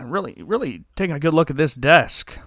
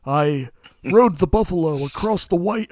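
Tonto; 4 semitones higher than usual. Acted. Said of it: "do your best Tonto impersonation".

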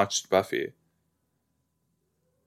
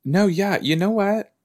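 high emphatic agreement; 9 semitones higher than usual. Real, not acted. Sudden agreement.

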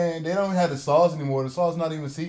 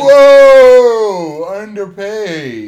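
mock gasp; 8 semitones higher, 18 dB louder, much slower than usual. Mocking reaction to underpaid actor.

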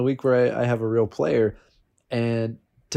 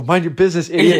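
louder, higher brush-off; mock snap; 5 semitones higher, 7 dB louder than usual. Teased by a friend mid-game, annoyed snap. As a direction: shut the teaser down. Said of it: "And I just go".

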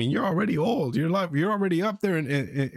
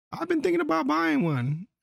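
imitating the old man; 11 semitones higher than usual. Imitating the old man's question.

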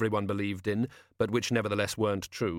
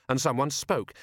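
ordinary delivery

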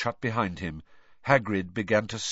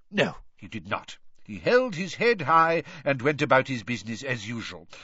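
put-on higher-pitched, emphatic old headmaster; Dumbledore, anecdotal; 7 semitones higher than usual. A narrator's reading. Headmaster telling of his disgraced brother, brisk and emphatic. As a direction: recount it as a proud brother.

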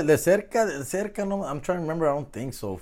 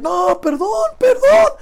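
high pleading cry, rising louder; begging man; 7 dB louder, much slower than usual. Retold or imitated. Beaten man begging the attackers, high desperate plea. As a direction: plead for mercy in a strained high voice. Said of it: "And he was just like".

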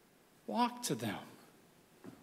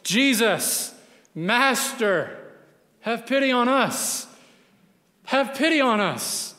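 raised, higher pleading cry; loud cry for mercy; 5 semitones higher, slower than usual. Retold or imitated. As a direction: call out to the Master from a distance, voice lifted. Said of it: "cried in a loud voice".

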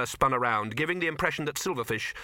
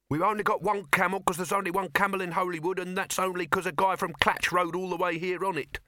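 high-pitched voice of an explaining youth; skinny youth; 7 semitones higher than usual. A narrator's reading. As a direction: explain the problem as a higher-voiced lad.

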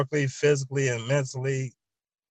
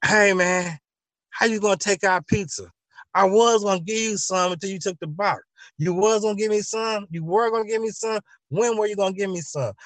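higher, indignant and accusing; indignant complaint; 7 semitones higher than usual. Acted. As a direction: protest that the pizza was snatched, pitch raised. Acted quarrel over pizza.

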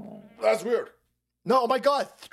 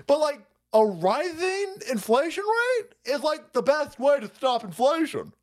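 high mock economist voice; 7 semitones higher than usual. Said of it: "Mark Zandi".

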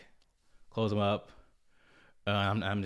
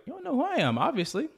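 much higher and louder comeback; retorting loudly; 5 dB louder than usual. Retold or imitated. Retelling his comeback to the thief, high and loud. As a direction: fire it back at him, high and loud. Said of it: "And I was like".

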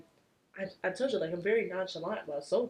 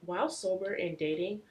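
usual speaking voice

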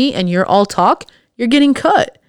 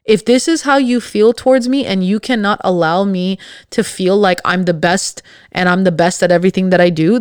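usual speaking voice

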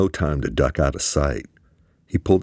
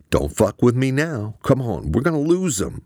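high, pushy; urgent pressure; 9 semitones higher than usual. A narrator's reading. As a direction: press him hard, no time left. Eamon urgent in chase.

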